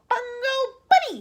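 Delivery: very high, loud scream. A narrator's reading. Wonka screaming back at the aliens. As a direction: scream with all your force. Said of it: "he screamed".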